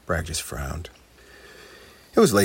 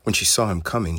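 plain reading voice

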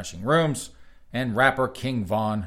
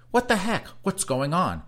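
higher-pitched indignant quote; quoted exasperation; 8 semitones higher than usual. Acted. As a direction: read it as a baffled, indignant question. Reads Fuller's exasperated quote aloud.

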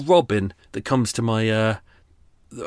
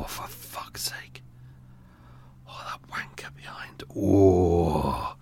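slow seething whispered curse; furious whisper; 5 semitones lower, 4 dB quieter, much slower than usual. Real, not acted. Vlogger fuming at lawnmower noise, slow whispered curse. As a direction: drag it out under the breath, fuming. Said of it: "really furious".

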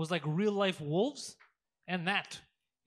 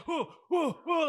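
high startled oohs, imitated; mock panicked hoots, as if caught; startled reaction; 8 semitones higher, 4 dB louder than usual. Friend imitates a guy spotted by his mom, startled hooting. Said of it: "his reaction is always like".

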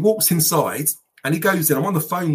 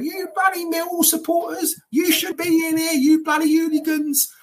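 high, shrill-free mock shout of an angry old lady. Old lady whacking him with an umbrella, mock shout. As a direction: yell it like a furious old lady in the stands. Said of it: "She's going".

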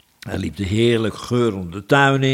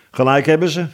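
lightly imitated talking while eating; mouth full; 7 semitones higher, 4 dB louder, faster than usual. A narrator's reading. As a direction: talk round a bite of bread. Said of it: "zei de denker met volle mond".

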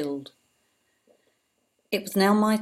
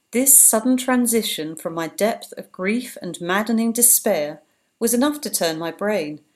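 plain reading voice

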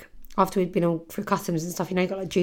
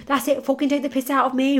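going at me; 6 semitones higher than usual. Retold or imitated. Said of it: "She's going at me".

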